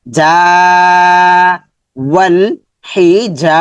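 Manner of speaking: slow recitation, stretching যা after ওয়াল হি; elongated যা. Shown as a demonstration. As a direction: hold the vowel of যা long. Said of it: "জাটা লম্বা হবে".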